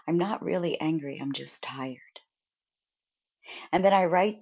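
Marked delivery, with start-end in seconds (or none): 1.2-2.0 whisper. Real, not acted. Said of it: "admitting tiredness, unvoiced whisper".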